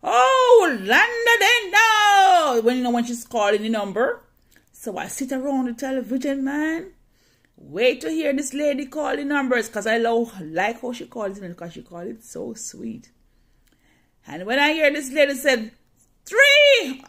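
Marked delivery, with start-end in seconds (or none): 0.0-2.6 high, loud lottery-caller call, drawn out slowly; Spanish lady calling numbers. Retold or imitated. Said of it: "this Spanish lady gonna say". 16.2-17.0 lottery caller. Retold or imitated.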